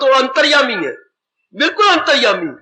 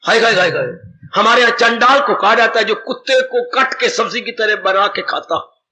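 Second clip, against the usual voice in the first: ordinary talk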